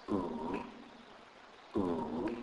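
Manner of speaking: U as a soft, held "oo"; letter sound demo. Shown as a demonstration. Teacher shows how U is pronounced, soft sustained "oo". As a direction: sound out U softly as in "put". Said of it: "pronounced like the U in the English word put".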